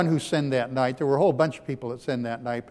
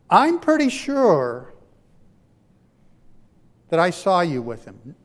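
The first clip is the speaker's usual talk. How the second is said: accusing bystander; 2 semitones higher, 7 dB louder than usual. Acted. Servant girl accusing Peter.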